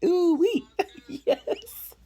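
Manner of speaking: high squealed ooh wee; a giddy falsetto yes; excited whoop. Real, not acted.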